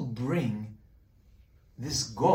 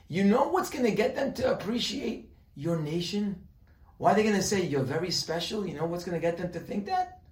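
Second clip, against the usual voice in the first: voiced Moshe; 7 semitones higher than usual. Moshe urging the people, higher and eager. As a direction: voice Moshe pressing his point.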